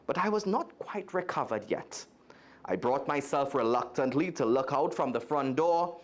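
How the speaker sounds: expressive read-aloud storytelling; lively storybook reading voice; story narration. A narrator's reading. Reads a children's story aloud.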